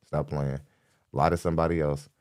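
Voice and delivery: ordinary delivery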